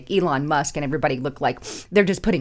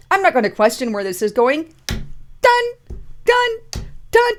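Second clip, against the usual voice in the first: mocking official; 10 semitones higher, 4 dB louder than usual. Mocking rubber-stamping official.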